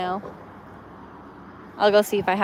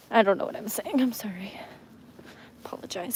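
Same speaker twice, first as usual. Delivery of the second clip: whisper; 7 dB quieter, faster than usual. Real, not acted. Embarrassed aside, whispered.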